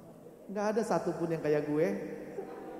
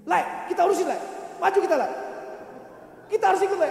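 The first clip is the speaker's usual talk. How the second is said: loud, high rallying shout; acted-out raised-voice urging; retold urging; 10 semitones higher, 6 dB louder, much faster than usual. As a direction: egg a mate on loudly.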